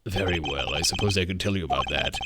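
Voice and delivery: low, soft menacing voice; deep villain voice. Acted. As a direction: growl it low and calm. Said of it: "keep hurting this guy's voice".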